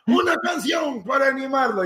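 rally the congregation; mock preacher shout. Preacher calling for a song to cheer him up. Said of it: "te empiezan".

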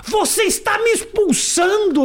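high, drawn-out offended protest; mock indignation. Comedian mimicking the presenter told she can leave, mock indignation.

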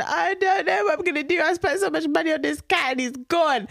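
high, wailing mock despair; hammed-up tearful whine; verge of tears. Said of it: "on the verge of tears".

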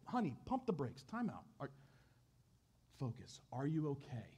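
husband calming his frantic wife, softly. Acted. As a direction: pump the brakes, soothe her. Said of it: "he's like".

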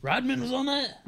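high-pitched disbelieving question; surprised. Real, not acted. Friend hears who was on the reality show, surprised. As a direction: pitch leaps up in real surprise.

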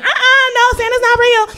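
high sassy know-it-all; sassy kid. Retold or imitated. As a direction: correct her with sass.

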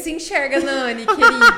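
loud laughing tease; laughing mock scold. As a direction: scold her jokingly while laughing. Listener jokes in mock scolding.

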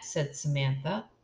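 ordinary delivery

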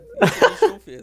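bursting into laughter mid-line; laughing. Real, not acted. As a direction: laugh it out loud. Laughing at own punchline.

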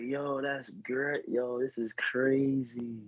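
slow amazed. Retold or imitated. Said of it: "I was like".

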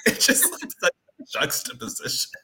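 words breaking up into laughter; too amused to get the words out; real laughter. Real, not acted. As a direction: laugh helplessly through the line. Cracking up at the scene contrast.

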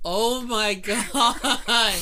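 slow, laughing disbelief; drawn out through laughter. Real, not acted. Listener reacting to the punchline, laughing slowly.

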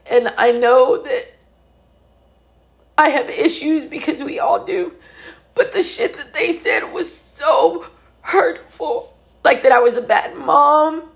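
high, cracking voice fighting back tears; voice breaking. Real, not acted. Telling viewers about the brother's hurtful words, voice breaking. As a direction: let the voice break as the hurt comes through. Voice breaking, laugh cry heard.

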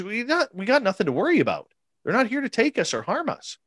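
imitating Samantha. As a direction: lightly voice the woman telling him not to worry. Imitating Samantha reassuring him.

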